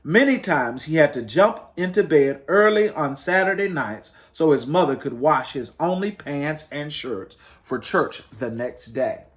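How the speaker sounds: ordinary delivery, nothing marked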